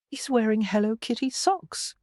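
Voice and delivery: mildly disapproving remark. A narrator's reading. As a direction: object with understated disapproval. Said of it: "a distinct note of disapproval in his voice".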